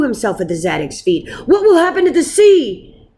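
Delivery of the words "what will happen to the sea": higher, louder desperate plea; pleading. A narrator's reading. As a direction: beg at the rabbi's feet. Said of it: "Gershon pleaded".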